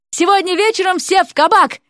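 high-pitched, rousing call; parting shout. A narrator's reading. Student leaving the exam room, shouting to classmates. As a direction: call it out to everyone on the way out. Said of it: "крикнул напоследок Уилл".

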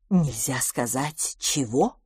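low, slow, puzzled question; amazed. A narrator's reading. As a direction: ask slowly and softly in bewilderment. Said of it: "вопросила мисс Петтигрю в изумлении". (тихо и медленно, в изумлении)

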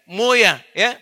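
high, loud, fond call to mother; affectionate call. Shown as a demonstration. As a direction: call her sweetly like a child. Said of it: "Panggilan sayangnya itu muya".

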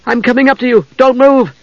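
high urgent call upward. A narrator's reading. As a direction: shout up to him anxiously. Calling up the stairs.

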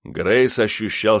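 ordinary delivery, nothing marked

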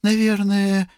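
very high-pitched motherly voice; fretful mother. A narrator's reading. Mother reporting on her younger son, high fretful voice. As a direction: answer in a high, plaintive register. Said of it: "ответила госпожа Рупамера".

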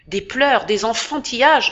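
brutal tone. A narrator's reading. Fencing master scolding the weeping boy, brutal and loud. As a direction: scold him hard for crying. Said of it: "d'un ton brutal".

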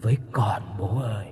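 eerie unvoiced child-ghost whisper, drawn out slow; ghostly whisper. A narrator's reading. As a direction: breathe it like a dead child calling from the dark. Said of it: "kèm theo đó là tiếng thì thầm". (thì thầm ma quái, kéo dài)

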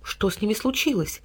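soft scared question; frightened. A narrator's reading. Boy frightened asking the cat. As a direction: ask in fright. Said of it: "испуганно посмотрел на кота Тильтиль".

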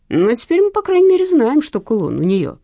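put-on contented old man; satisfied. A narrator's reading. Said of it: "удовлетворённо проговорил Василий Макарыч".

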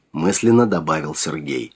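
ordinary delivery, nothing marked

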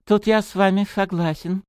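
put-on lightly lisping, childlike voice; childish lisp. A narrator's reading. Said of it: "по-детски шепеляво произнёс профессор химии".

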